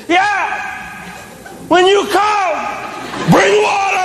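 put-on high, cheeky child's shout; child shouting back. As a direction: yell it back at dad, unafraid. Daughter shouts back from bed.

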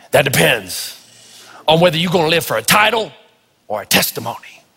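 deep rustic voice. Retold or imitated. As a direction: intone it like an old rustic preacher. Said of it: "say it in his deep, rustic voice".

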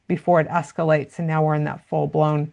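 ordinary delivery

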